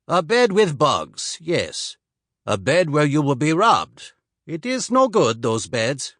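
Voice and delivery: put-on slight Swedish accent. Swede warns the soldier off the town's beds, slight Swedish accent. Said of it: "He spoke with only a slight accent".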